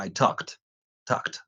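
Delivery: Talked ending in a clear KT cluster, the ED said as T; Talked pronounced takt; final T sound. Shown as a demonstration. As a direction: clip Talked with K and T together at the end. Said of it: "lo hago con la palabra".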